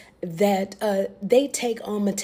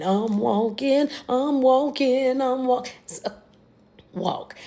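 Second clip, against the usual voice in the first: mock determined march; 4 semitones higher, slower than usual. Mocking Joyce marching off armed.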